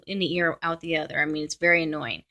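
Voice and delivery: ordinary talk, nothing marked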